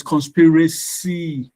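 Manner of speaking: conspiraCY, slow, with CY drawn out; stress on last syllable. Shown as a demonstration. Teacher quizzing class on conspiracy, stressed on CY. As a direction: stretch conspiracy out to a stressed final CY. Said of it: "are we supposed to pronounce loudly".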